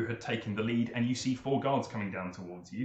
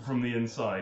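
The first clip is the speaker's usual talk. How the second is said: ordinary talk, nothing marked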